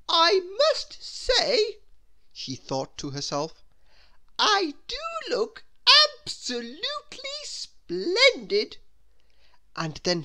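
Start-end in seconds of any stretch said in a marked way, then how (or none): 0.1-1.8 very high, loud and slow self-admiration; a much higher, louder, drawn-out preening; vain Miss Splendid. Acted. 4.4-8.8 very high preening voice; a much higher self-admiring voice; vain Miss Splendid. Acted.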